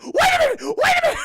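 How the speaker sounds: very high, loud squeal; hammed-up frantic shriek; mock terror. Max held over hell.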